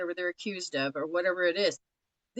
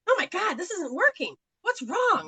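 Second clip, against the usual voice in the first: husband's frustration; 11 semitones higher, 4 dB louder than usual. Retold or imitated. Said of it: "he'll be over there going".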